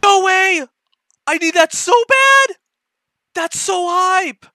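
high, loud excited yelling; ecstatic shouting. Real, not acted. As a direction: scream with pure joy. Hears tournament news.

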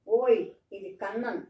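loud startled "ఓయ్, ఇది Kannan", punched on ఓయ్; surprised cry. Retold or imitated. Daughter spots the old ox at the door, loud surprised cry. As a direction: blurt it out, caught off guard.

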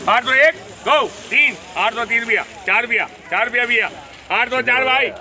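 calling out bids. Real, not acted. Auction bidding calls at mandi.

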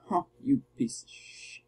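whispered insult, no voice; softly cursing the enemy; muttered curse. Real, not acted. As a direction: hiss it under your breath. Cursing an enemy under breath.

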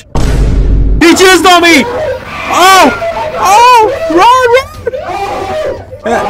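high, loud screams of alarm; panicked screaming. Real, not acted. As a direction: scream for everyone to run. Attacked by game monster, flees.

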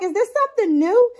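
high, quick puzzled question; lightly voiced surprised self; mock surprise. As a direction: imitate being puzzled in the car. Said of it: "And I'm like".

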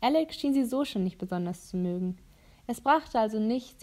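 ordinary delivery

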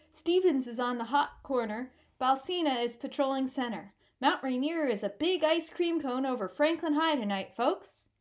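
loud, nasal play-by-play voice; nasal radio announcer. Acted. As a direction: warm up the crowd like a radio announcer. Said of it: "Lassen continued to warm up the fans".

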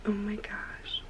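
soft amazed whisper. Real, not acted. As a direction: whisper it, barely breathing the words. Filming quietly in low light.

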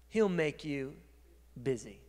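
normal talking voice

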